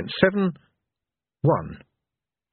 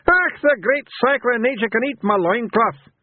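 put-on much higher voice of a mad old warrior; Mad Hamish; 13 semitones higher, 4 dB louder than usual. A narrator's reading. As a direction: burst out in high-pitched scorn. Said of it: "said Mad Hamish".

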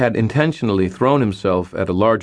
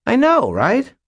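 very high, louder commiseration; exasperated agreement; 6 dB louder than usual. A narrator's reading. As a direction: squeak it out in fed-up agreement. Butters exasperatedly agrees with the complaint.

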